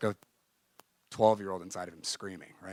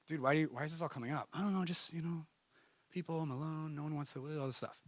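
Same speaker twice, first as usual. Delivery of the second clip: hurt, hushed confession; 5 semitones higher, 7 dB quieter than usual. Retold or imitated. Roommate opens up about his pain.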